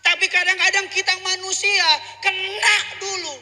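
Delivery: high, loud preacher's shout; full-force preaching. Real, not acted. Preacher admonishing the congregation about repentance, full-force preaching. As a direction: hammer it out at high pitch.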